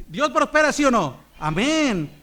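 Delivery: loud, high demand for amen; full-force preaching call. Acted. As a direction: rouse the church to answer. Call and response, louder.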